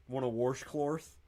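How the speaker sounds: "washcloth" slowed into "worsh-clorth"; slow mocking "washcloth" with intrusive Rs; imitated regional pronunciation. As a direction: put an R in both halves of "washcloth". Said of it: "when people say "warsh".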